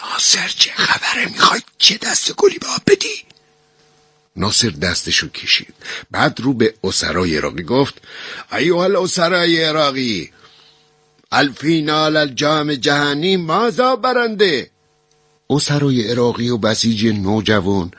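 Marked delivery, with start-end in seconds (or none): 0.0-3.2 urgent whisper; anxious hushed whisper to a friend. A narrator's reading. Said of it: "با صدای آروم گفتم". 8.5-14.7 high, proclaiming broken Arabic; lightly hammed-up speech voice; mock formal Arabic. A narrator's reading. Nasser addresses prisoners in broken Arabic.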